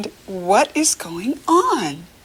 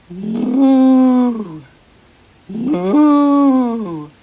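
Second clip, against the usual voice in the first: long loud lowing moo; drawn-out moo for a child; cow mooing; 4 semitones higher, 6 dB louder than usual. Shown as a demonstration. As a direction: stretch it out like a cow. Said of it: "what does the cow say".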